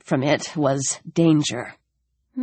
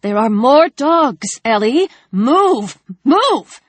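urgent warning; 5 semitones higher, slower than usual. A narrator's reading. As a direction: push the warning harder each time. Alex warns of dogs.

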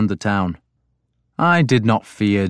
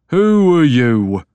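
slow, loud, higher menacing challenge; hostile growl; 4 semitones higher, 4 dB louder, slower than usual. A narrator's reading. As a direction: growl it at the stranger. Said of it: "he growled at me".

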